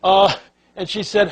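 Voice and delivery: ordinary talk, nothing marked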